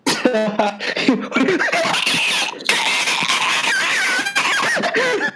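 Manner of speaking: very high, wheezing fit of laughter; long high cackle. Real, not acted. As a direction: break into a long squealing laugh at your own punchline. Laughing at own punchline.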